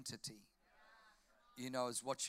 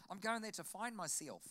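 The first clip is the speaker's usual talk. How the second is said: higher, softer imitation Kiwi accent; 5 semitones higher, 3 dB quieter than usual. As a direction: mimic a young New Zealander's vowels. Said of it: "that's a Kiwi accent".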